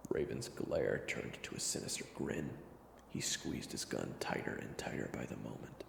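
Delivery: hushed storyteller voice; whispered narration. A narrator's reading. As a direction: narrate it in a low hush.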